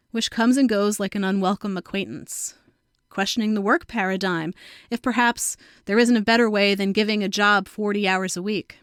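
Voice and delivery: ordinary delivery, nothing marked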